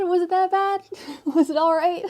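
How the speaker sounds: high, loud Aussie twang through laughter; Australian accent, laughing. Acted. Reader asks the viewer in a laughing Australian accent. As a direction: ask it in a giggly Australian voice. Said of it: "I try".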